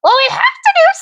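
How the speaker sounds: sudden very high squeak; squeaky line. Retold or imitated. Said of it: "And I go".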